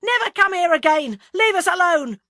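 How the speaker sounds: high, full-throated yell of rage; enraged shouting. A narrator's reading. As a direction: drive them away at the top of your voice. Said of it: "working with rage".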